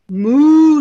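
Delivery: loud, drawn-out mooing; cow moo. Acted. Reader playing the cow for kids, hearty loud moo. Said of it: "said the cow".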